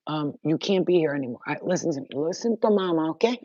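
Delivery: normal talking voice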